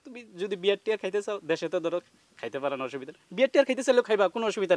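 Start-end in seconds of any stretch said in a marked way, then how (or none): none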